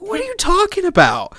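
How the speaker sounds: high-pitched retold "come on" protest; incredulous protest. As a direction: squeak it out in disbelief. Retold indignant defence of him.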